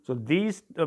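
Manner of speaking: ordinary delivery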